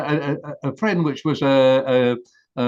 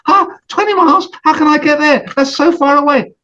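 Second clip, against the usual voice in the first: much higher, squeaking disbelief; can't believe how far it is; shocked turtle; 12 semitones higher, 7 dB louder than usual. Acted. Turtle hears the lake is twenty miles off, very high and shocked.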